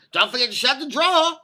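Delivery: high, rising mock-customer voice; imitated nagging lady. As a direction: mimic a fussy woman piping up.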